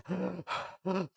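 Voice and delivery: big gasp after a breathless run-on; gasp for breath. Real, not acted. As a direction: gulp air, out of breath from reading. Runs out of breath reading unpunctuated text.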